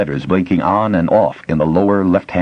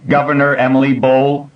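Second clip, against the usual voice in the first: flat machine voice, higher, no inflection; computer voice; 8 semitones higher, 4 dB louder than usual. A narrator's reading. Facility computer addressing the intruders, mechanical. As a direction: speak like an old computer.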